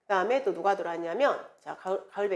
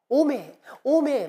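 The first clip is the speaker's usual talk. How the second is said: gedehntes, verwundertes Jeolla-Dialekt-„오메“, langsam; 4 semitones higher, 4 dB louder, much slower than usual. Retold or imitated. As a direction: den Jeolla-Dialekt-Ausruf „오메“ langgezogen nachmachen. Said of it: "우리 오메 전라도로 막 그러잖아요".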